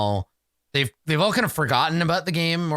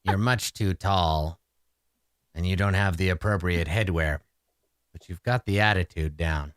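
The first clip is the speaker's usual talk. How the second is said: lightly voiced red cap, pitched lower; measured, low-pitched red cap approval; red cap character; 6 semitones lower than usual. Game master as red cap sizing up a newcomer, low red cap voice.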